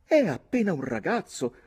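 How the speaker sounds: put-on high, loud girl's protest; crying out. A narrator's reading. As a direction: plead loudly as an alarmed young lady. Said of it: "gridò la ragazza".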